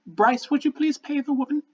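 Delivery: high, held-in horror; high-pitched, tightly polite dismay; restrained horror. Acted. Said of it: "restrained horror".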